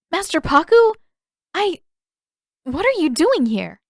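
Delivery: high, loud startled gasp of a question. Acted. Katara startled when Pakku appears. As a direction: caught off guard, blurt it out high and loud.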